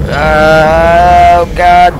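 drawn-out, high, loud groan of dread; loud dismayed cry; alarmed. Real, not acted. Scary game, running back.

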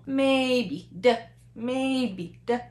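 long level "maybe" with a clipped little 的; first-neutral tone model. Shown as a demonstration. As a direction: hold "maybe" even, then a brief unstressed 的. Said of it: "First tone and the neutral tone".

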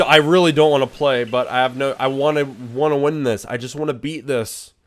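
usual speaking voice

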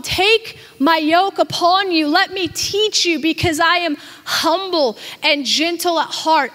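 ordinary talk

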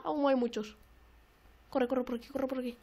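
ordinary talk, nothing marked

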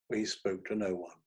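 ordinary delivery

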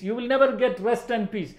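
usual speaking voice

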